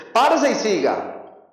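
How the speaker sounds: mock-stern scolding; stern parent. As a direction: imitate a parent briskly ordering a child to toughen up. Acts parent ordering child to toughen up.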